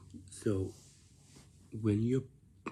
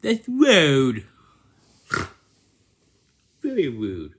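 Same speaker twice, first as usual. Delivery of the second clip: 1 stern scolding; 4 semitones higher, 14 dB louder than usual. Acted. Teacher scolding rude behaviour.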